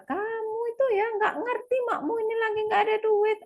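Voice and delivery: put-on high, scolding rant at the kid; angry scolding. Mother scolding her child about money, high and sharp. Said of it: "mengeluarkan suara-suara".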